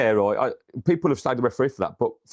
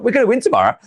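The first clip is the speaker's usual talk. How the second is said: excited boast; 11 semitones higher, 8 dB louder, faster than usual. Retold or imitated. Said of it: "I was like".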